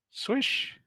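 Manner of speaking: airy imitated blade swish; swishing noise. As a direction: mimic a swing cutting air. Imitating a weapon swing.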